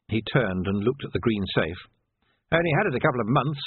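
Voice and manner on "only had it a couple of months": ordinary delivery, nothing marked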